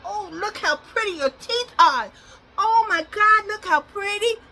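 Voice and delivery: high, loud gushing praise to the dog. Real, not acted. Owner gushing over her dog's clean teeth, high and loud.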